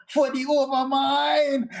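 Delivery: high, drawn-out war shout; battle cry. Acted. Player's character charging at the goblin, shouted battle cry. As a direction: holler it out as a rallying cry. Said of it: "I shout".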